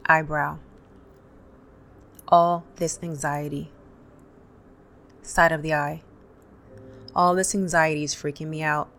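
calm, paced guided tapping with pauses between points; guided tapping script. Acted. Tapping coach to viewers, calm guided script. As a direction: lead the viewer through tapping points and phrases to repeat.

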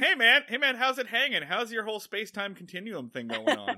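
breezy greeting. Retold or imitated. Tony greeting Bellows breezily.

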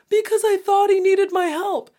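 high, loud innocent reply; imitating sister. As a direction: play the naive sister answering. Sister voiced answering her.

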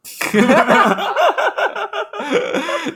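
long high burst of laughter. Real, not acted.

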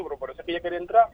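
ordinary delivery

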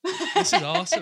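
put-on a high, loud, laughing parody of his own northern accent; mock northern. Mocking her teasing of his northern accent, high and loud. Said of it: "take the mick out of me now".

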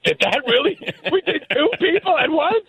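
high, astonished squeal; amazed kids. Retold or imitated. Kids stunned by their first double play, squealing in surprise. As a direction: marvel like a stunned little leaguer. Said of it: "we looked at each other like".

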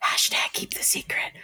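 hushed whisper. Pretend. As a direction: breathe it like a secret. Whispered joke.

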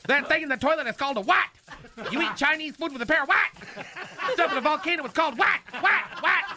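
high, fast, eager questions rising on what; imitating a quiz voice. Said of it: "I can't do the voice".

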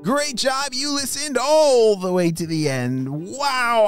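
put-on high cheerful praise for kids; kindergarten teacher voice. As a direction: cheer the children on. Praising listeners.

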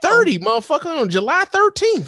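high, loud incredulous rant; shocked outburst. Retold or imitated. Storm turning day dark, a high incredulous rant. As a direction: play up the shock at the sudden dark.

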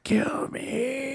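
tiny high, soft pleading voice; half-alive creature. Pretend. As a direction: beg faintly, barely alive, as a joke. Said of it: "like, half alive, and it's like".

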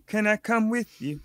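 ordinary talk

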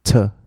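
"to" said as short "tuh"; weak neutral vowel in "to"; reduced to. Shown as a demonstration. Teacher shows fast speech form, "to" as "tuh". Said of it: "它会变to".

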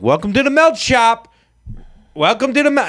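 high, sing-out staff welcome; cashier's greeting. Retold or imitated. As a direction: call it out over the shop. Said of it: "So it was like".

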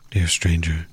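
ordinary talk

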